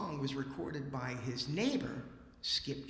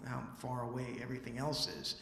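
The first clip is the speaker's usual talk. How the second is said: ordinary talk, nothing marked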